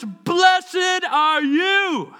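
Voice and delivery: high, drawn-out exclamation; loud voice. Acted. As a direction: proclaim the blessing at full voice. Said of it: "says in a loud voice".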